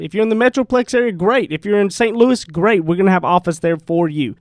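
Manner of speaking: loud upbeat radio ad read. Acted. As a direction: sell it like a live radio spot. Reads a sponsor ad.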